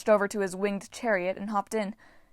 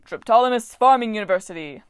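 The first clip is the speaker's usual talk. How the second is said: loud proud announcing, much louder; proud announcement; 9 dB louder than usual. Acted. The god proudly presenting his online school to Frank, proud announcement. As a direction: announce it like a grand title. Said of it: "he announced proudly".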